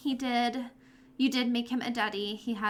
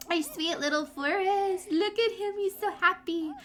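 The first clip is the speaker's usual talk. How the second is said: cooing; 6 semitones higher than usual. Real, not acted. Cooing over her baby Sim in the game, high and fond. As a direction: coo over the little one.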